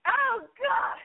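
high shriek into a laugh; shocked shriek. Real, not acted. Shocked by crude line.

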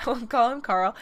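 "we'll call him Carl" said quickly through a laugh; laughing repeat. Shown as a demonstration.

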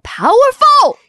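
high, loud, proud cry of power; triumphant exclaim. Pretend. Homeowner watching the thieves run off, triumphant cry. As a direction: crow it like you just won. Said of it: "you are like".

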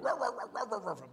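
quick run of mock barks; barking dog. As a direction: imitate a dog barking behind a wall. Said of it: "a dog ... started going".

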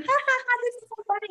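high, strained fake laugh through the words; forced laughter. Mom laughing nervously while her son yells, high fake laugh.

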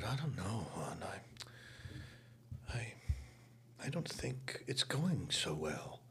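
put-on anxious whisper to a young castmate after a show. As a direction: murmur your doubts under your breath. Star doubting herself offstage.

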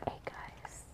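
very soft, slow whispered podcast intro; ASMR whisper. Shown as a demonstration. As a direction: whisper it close and hushed. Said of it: "an A S M R episode".

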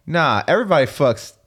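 ordinary talk, nothing marked

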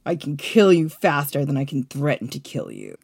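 low, cold-blooded threat; cold and heartless. Shown as a demonstration. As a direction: sound like you might actually stab them. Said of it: "Try to sound a little bit cold and heartless, like you might actually stab me".

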